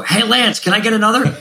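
high call across a busy bar; calling out name. Retold or imitated. Customer calling the bartender by name at a busy bar, high call. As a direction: hail the bartender over the noise.

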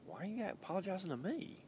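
high, puzzled retort; imitating his younger self, baffled; mock bewildered. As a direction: pitch it way up, honestly confused. His retold reply to drinkers.

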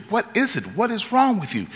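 high, alarmed retold cry of surprise; startled shout. Driver shouting at the stranger on his windshield, alarmed high cry.

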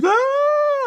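imitating the girl's scared cry; mock startled yelp. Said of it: "And then she".

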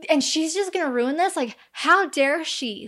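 mock outrage. Voicing Mark's outrage.

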